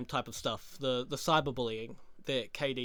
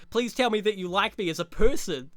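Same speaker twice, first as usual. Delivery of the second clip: imitating mad plea; 4 semitones higher, 7 dB louder than usual. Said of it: "he's just getting fucking mad".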